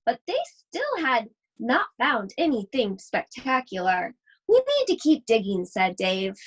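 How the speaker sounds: put-on lively read-aloud voice for children; story reading. Teacher reading a picture book to her class, animated storytelling.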